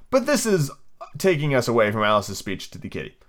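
ordinary delivery, nothing marked

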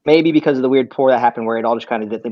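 ordinary talk, nothing marked

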